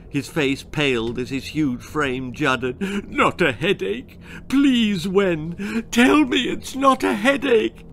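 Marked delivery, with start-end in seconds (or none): 3.1-7.8 high, loud, crying plea; anguished pleading. Acted. Father begging his daughter, high crying plea. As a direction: beg through tears.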